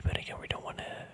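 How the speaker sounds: usual speaking voice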